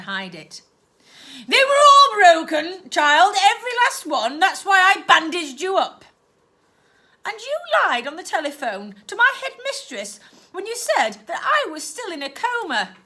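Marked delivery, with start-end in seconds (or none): 1.5-6.0 higher and louder, hiding fury badly; fury barely held in check; aunt insisting angrily to her niece; angrier aunt. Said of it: "becoming angrier and angrier". 7.2-13.0 put-on higher, pressing child's voice; girl's accusation. Girl accuses aunt.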